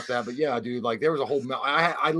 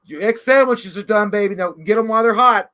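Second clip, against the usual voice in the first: mom calling out; 7 semitones higher, 8 dB louder than usual. Retold or imitated. Mom calls son to eat, loud and high. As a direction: sing it out like a doting mom. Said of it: "it's like".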